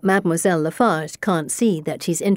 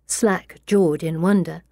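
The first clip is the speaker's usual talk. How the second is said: usual speaking voice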